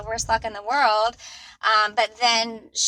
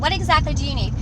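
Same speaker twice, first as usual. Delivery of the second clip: slightly less sharp, still high and raised; toned-down demand; 6 semitones higher, 5 dB louder, faster than usual. Retold or imitated. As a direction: ask pointedly, reining in the outburst a little. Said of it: "I didn't say it like that".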